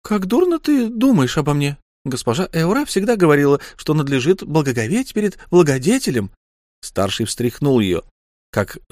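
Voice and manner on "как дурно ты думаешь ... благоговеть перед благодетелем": high, faster, teasing woman's voice; wife mocking her husband in a high teasing voice; Lyu mocking. A narrator's reading. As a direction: needle him with high false indignation.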